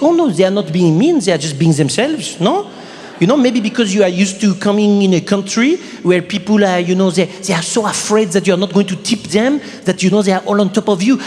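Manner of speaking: put-on French accent, explaining patiently; lightly imitated Frenchwoman's accent; French woman. Frenchwoman defending her countrymen to comedian, French accent. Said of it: "a French woman".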